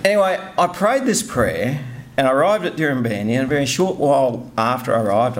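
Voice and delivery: ordinary delivery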